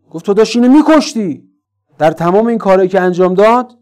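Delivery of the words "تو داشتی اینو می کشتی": raised, higher-pitched mild mock yell; retold angry scolding. Imam reproaching Jaber for nearly killing his son, retold angry scolding. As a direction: scold him in a higher voice, without a full shout. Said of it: "سر جابر داد زد، شکایت کرد".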